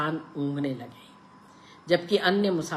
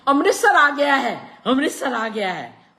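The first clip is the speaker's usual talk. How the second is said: much higher, loud triumphant yell; 10 semitones higher, 11 dB louder than usual. A narrator's reading. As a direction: bellow it out in triumph. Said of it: "ऊंची आवाज में चिल्लाया".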